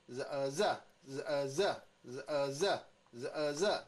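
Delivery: 日呃，热 as buzzed z, uh, then punched zuh; sound blending. Shown as a demonstration. Teacher drills Z with another vowel, blending drill. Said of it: "Z的声音是日".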